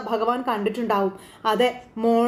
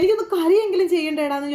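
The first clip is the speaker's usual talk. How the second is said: higher, retold angry retort; mock-snap at the son in grief; imitating a tearful, angry mother; upset snap; 6 semitones higher than usual. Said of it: "ദേഷ്യപ്പെടുവോ Gomathi".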